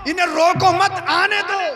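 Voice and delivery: high, loud ringing order; commanding call. Acted. Prophet telling companions to let the child come, commanding call. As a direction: call it out to a crowd of followers.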